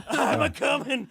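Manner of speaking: put-on high, loud "I'm a coming" old-man voice; hammed-up old man groan; Sanford and Son impression. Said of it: "Like Sanford and Son".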